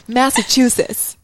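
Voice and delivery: "Massachusetts" slowly, with a laugh in the voice; trying an American accent. Shown as a demonstration. Retrying the state name after her friend, slowly. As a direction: copy the American "Massachusetts" while giggling. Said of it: "say it more in an American accent".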